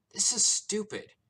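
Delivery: high, loud complaint; fed-up outburst. A narrator's reading. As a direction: burst out in exasperation. Captive fed up with nonsense.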